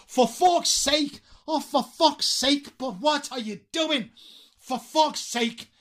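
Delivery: high, slow, drawn-out exasperation; acted exasperation. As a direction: stretch it out, utterly fed up. Acts the exasperated swear phrase. (hartazgo exagerado, lento y agudo)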